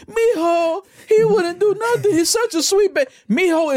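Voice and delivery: very high, mock-tearful grandma wail; sweet grandma. Grandma swearing her grandson is innocent, high wailing protest. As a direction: wail in defense of your baby. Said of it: "it's always the sweetest grandma that's on the news".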